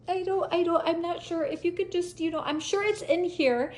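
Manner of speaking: high, hesitant excuse; flustered pleading. Retold or imitated. Driver unable to find papers for officer, flustered pleading. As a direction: stammer helplessly in a high voice.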